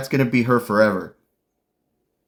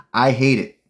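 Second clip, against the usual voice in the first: ordinary talk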